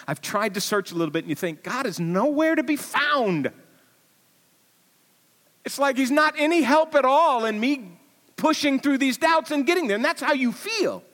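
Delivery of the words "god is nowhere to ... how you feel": anguished doubt. Acted. Voicing doubt, rising pitch.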